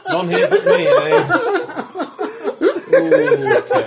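high squealing laughter through words; laughing. Real, not acted. Laughing at own geeky joke.

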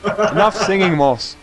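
mock scolding. Real, not acted. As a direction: scold a friend playfully. Scolding friend jokingly.

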